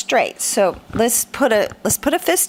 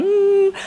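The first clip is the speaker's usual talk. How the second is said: tongue-out hum; 9 semitones higher than usual. Retold or imitated. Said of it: "his tongue is going".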